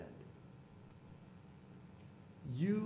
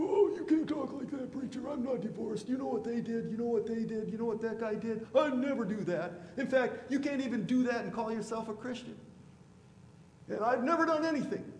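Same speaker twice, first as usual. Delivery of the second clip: much higher and louder indignant, whiny mock voice; self-righteous churchgoer; 9 semitones higher, 7 dB louder than usual. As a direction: parody the holier-than-thou member. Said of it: "We're all a bunch of losers".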